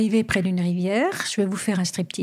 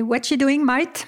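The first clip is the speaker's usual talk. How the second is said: broad Australian accent; Aussie bloke greeting his mate; aussie tradesman; 4 semitones higher than usual. Shown as a demonstration. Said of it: "En Australie, c'est".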